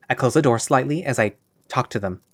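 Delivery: ordinary delivery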